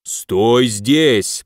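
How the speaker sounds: commanding monster growl; growling demon. A narrator's reading. Left demon ordering the hero to stay, growling. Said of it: "прорычал левый".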